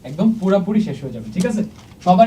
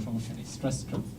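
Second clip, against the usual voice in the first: whispered aside; 5 semitones lower, 13 dB quieter than usual. Real, not acted. Teacher muttering to himself.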